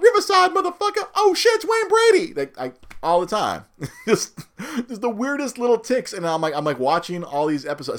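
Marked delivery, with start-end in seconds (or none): 0.0-2.3 loud, much higher mock yell; yelled skit quote. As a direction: shout it out like the sketch. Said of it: "I'll yell out".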